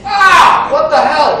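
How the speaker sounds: high, loud shocked yelp; startled yelp. Retold or imitated. Man punched through a sleeping bag yelps high and loud in shock. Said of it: "that's how he feels".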